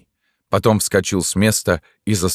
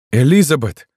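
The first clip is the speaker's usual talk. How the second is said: higher, louder shout of a name; crying out; 9 semitones higher, 6 dB louder than usual. A narrator's reading. Man shouts after the woman who ignores him, crying out. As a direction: shout her name urgently. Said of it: "крикнул он ещё раз".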